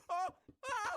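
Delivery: imitated grandma's startled screams; short high shrieks, one after another; panicked yell. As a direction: yell out as if jolted awake. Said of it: "who does the same thing".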